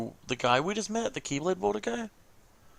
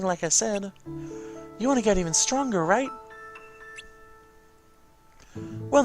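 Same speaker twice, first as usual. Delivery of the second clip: higher-pitched little companion voice, presenting a gift; cheery familiar character; 10 semitones higher, 3 dB louder than usual. Acted. Familiar presenting the player with a gift, cheery and excited.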